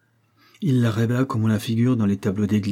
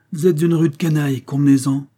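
higher, louder, indignant accusation; angry accusation; 5 semitones higher, 5 dB louder than usual. A narrator's reading. Barberoux accusing the dealer, angry raised voice. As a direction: hurl the insult, voice raised. Acted quarrel over accounts.

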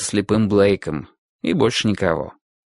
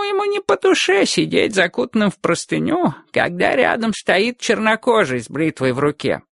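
put-on old man voice; 10 semitones higher than usual. Old barber voiced by narrator.

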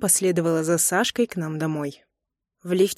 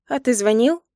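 higher, rising bewildered question; silly question; 5 semitones higher, 4 dB louder than usual. A narrator's reading. Wife caught out by husband's question, foolishly puzzled. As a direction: ask it cluelessly, pitch lifting. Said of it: "глупо спросила я".

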